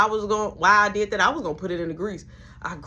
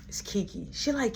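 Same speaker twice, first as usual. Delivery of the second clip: usual speaking voice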